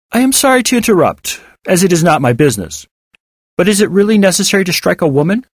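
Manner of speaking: high, formally courteous challenge; polite interrupting stranger. Acted. Stranger objects to David's slap, high and courteous. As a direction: step in politely, pitched high, ending on a rising question.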